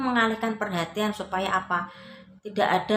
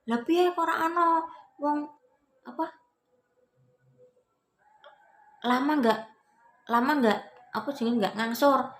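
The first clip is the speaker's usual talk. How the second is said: retold indignant collector; 9 semitones higher than usual. Acted quarrel with debt collector.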